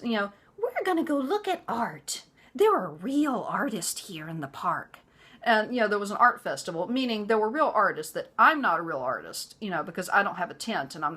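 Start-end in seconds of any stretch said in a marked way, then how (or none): 0.6-5.0 mock mother-in-law. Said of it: "she says".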